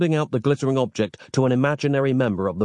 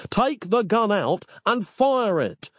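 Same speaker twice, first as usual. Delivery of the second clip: furious; 11 semitones higher, slower than usual. A narrator's reading. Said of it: "furious now".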